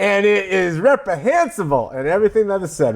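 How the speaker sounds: high-pitched mock Southern sermon; Southern preacher. Host resuming his pastor act after laughter, high Southern preacher accent. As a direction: play up a righteous Southern pastor. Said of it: "always do this in a Southern accent".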